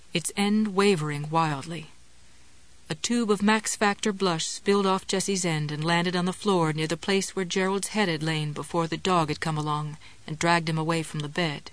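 ordinary delivery, nothing marked

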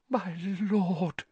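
high, hushed awe; whispered. A narrator's reading. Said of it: "he whispered".

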